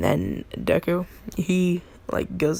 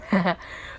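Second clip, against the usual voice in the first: short pleased chuckle, put on; acted laugh; 3 dB quieter than usual. Deku laughs after scaring everyone, mischievous chuckle. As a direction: give a little prankster's laugh. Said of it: "he just starts laughing".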